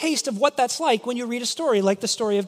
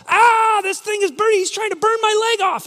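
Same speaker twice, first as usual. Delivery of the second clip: high, loud hammed-up agony; mock pained scream; 10 semitones higher, 5 dB louder than usual. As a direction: yelp as if the leg is burning. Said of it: "screaming in pain and agonizing".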